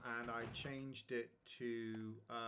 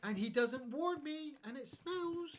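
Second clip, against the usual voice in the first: mock outraged complaint; 10 semitones higher, 6 dB louder than usual. Complaining about dog farting.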